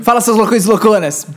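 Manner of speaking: hyped youtuber opener; mock YouTuber greeting; youtuber catchphrase. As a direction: shout it like a channel intro.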